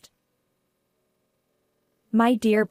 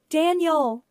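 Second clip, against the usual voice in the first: calling out; 6 semitones higher than usual. A narrator's reading. Sister to her injured brother on the road, calling out. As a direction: call his name in alarm. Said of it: "I called out to him repeatedly".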